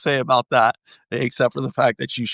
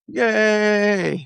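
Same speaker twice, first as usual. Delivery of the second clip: mock cheer; 8 semitones higher than usual. Cheering like the character.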